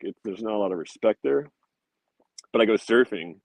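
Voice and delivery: usual speaking voice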